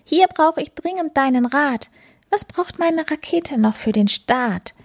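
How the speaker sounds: hohe, lockende Katzenstimme; fröhlich und hoch locken; Plapperkatze. Acted. Katze zeigt ihre Rakete. (verspielte hohe Katzenstimme)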